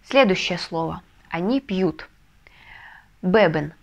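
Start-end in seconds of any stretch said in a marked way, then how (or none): none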